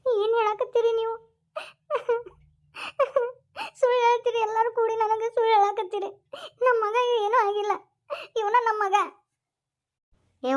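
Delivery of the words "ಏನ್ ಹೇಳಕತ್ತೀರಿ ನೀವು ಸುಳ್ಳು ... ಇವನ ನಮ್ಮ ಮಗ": tearful, higher pleading through sobs; crying in denial. Acted. Mother refusing the truth about her son, crying. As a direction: weep as you refuse to believe it.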